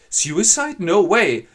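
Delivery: high, loud horrified yell; horrified shout. Acted. Father yells in horror at his daughter's plan. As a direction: shout him down in alarm.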